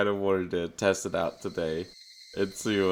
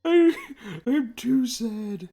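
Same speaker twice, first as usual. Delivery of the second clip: much higher, louder hammed-up wailing; mock crying; 6 dB louder than usual. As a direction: wail it like a whiny cartoon crybaby. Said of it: "I could, I could cry".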